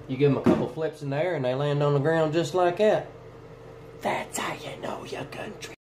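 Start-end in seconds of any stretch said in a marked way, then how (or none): 4.0-5.7 soft unvoiced whisper on the punchline. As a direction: whisper the tag line like a secret.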